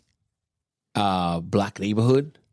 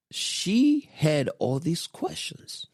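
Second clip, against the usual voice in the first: drawn out; 9 semitones higher, much slower than usual. Real, not acted. Stressing her many questions.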